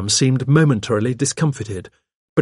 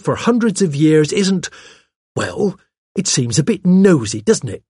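higher, chatty secretary voice; pert secretary, opinionated; 6 semitones higher, 3 dB louder than usual. Acted. Secretary on her archaeologist employer, chatty and opinionated. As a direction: give opinions as a gossipy young secretary. Miss Cram's dialogue in narrated story.

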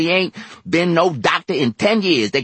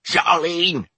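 calling out; 11 semitones higher, 5 dB louder than usual. A narrator's reading. Calling up to the balcony.

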